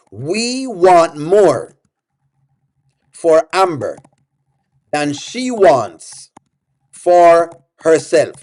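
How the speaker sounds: each word drawn out slowly; slow, spaced-out emphasis. Shown as a demonstration. To the chat, laying out the belief slowly and deliberately. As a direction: spell it out word by word so she gets it. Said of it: "I want you to understand".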